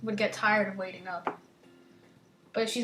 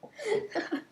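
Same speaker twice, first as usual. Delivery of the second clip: high laugh; 11 semitones higher, 5 dB quieter than usual. Real, not acted. Laugh after the intro.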